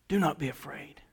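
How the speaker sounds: soft, low comforting voice; tender reassurance. Acted. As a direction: soothe frightened followers gently. Gentle reassurance after proclamation.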